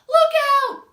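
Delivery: high, loud frightened warning; panicked cry. Acted. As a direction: shriek the alarm. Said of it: "cried the snails in panic".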